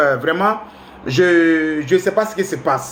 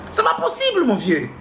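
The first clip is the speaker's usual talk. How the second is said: exasperated cry; 2 semitones higher, 3 dB quieter than usual. Real, not acted. Exasperated outburst.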